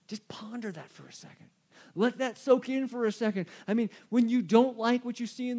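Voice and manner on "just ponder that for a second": real whisper, no voice. Real, not acted. Preacher urging the congregation to reflect, whispered. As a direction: drop to a breath for the line.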